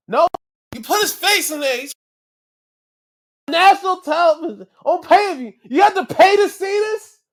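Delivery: high, loud yelling in disbelief; outraged disbelief. Real, not acted. Fan shouting at the TV over the drowning spot, outraged yell. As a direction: shout at the screen, can't believe it.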